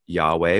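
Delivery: Yahweh said slowly and clearly as YAH-weh; Hebrew name read out. Shown as a demonstration. Teacher reading the Hebrew for the class to copy, careful. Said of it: "I'll read it once for you".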